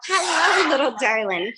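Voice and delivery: high loving greeting; pet talk. Real, not acted. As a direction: greet the bird tenderly. Greeting curious bird.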